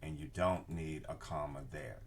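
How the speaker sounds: normal talking voice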